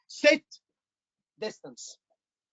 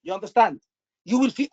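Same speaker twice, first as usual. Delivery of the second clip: ordinary talk, nothing marked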